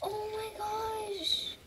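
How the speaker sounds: big gasp, then high soft squeal; awed gasp. Real, not acted. Player stunned by another cute horse, high soft awe. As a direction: gasp and breathe it out high and slow.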